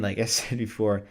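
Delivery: ordinary delivery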